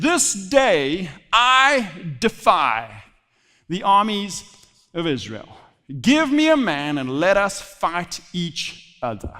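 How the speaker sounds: very slow, weighted proclamation; solemn defiance. A narrator's reading. Preacher reads Goliath defying Israel's armies, slow and defiant. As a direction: draw out each phrase as a formal challenge.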